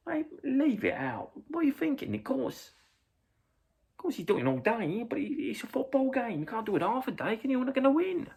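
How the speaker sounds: high-pitched exasperated protest; acted argument. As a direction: argue back high and indignant. Acting lads arguing with fan.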